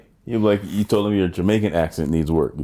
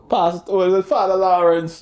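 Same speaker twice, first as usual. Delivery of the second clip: Jamaican accent impression; 9 semitones higher than usual. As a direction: imitate a sketch comic's Jamaican accent. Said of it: "What'd you think his accent".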